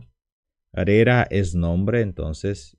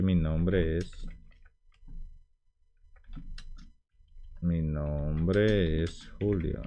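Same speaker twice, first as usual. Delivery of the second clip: slow model answer; 9 dB quieter, much slower than usual. Shown as a demonstration. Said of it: "puedo decir simplemente".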